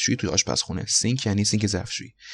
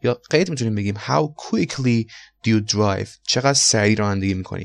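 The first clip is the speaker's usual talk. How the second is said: normal talking voice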